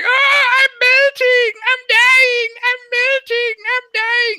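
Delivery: drawn-out, high melting shriek; Wicked Witch of the West. Acted. Game master voices the witch falling into the fountain, high loud scream. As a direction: wail loudly in hammed-up death throes. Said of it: "she's screaming".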